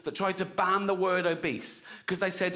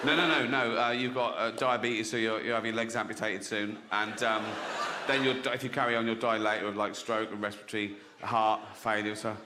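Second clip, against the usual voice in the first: low matter-of-fact doctor; 7 semitones lower than usual. Acted.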